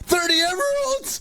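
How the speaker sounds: squeaky high disbelief; can't believe the number; shocked. Real, not acted. Gamer opening a lucky block, high shocked squeak.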